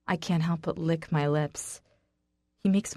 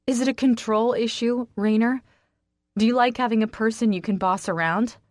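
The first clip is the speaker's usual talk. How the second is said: loud, higher, accusing; heated accusation; 5 semitones higher, 5 dB louder than usual. Acted. Woman confronting her controlling friend, loud and accusing. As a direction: push him hard with the question.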